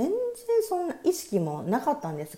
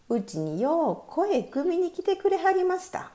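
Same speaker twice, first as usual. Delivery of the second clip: Kyoto dialect; 11 semitones higher than usual. Acted. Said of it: "この京都弁を お願いします".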